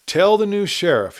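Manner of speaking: called out. Acted. Outlaw calling to wounded drivers from the saddle. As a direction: call it back over the shoulder. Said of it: "he called".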